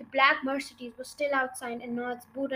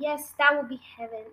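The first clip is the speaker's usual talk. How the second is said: ordinary delivery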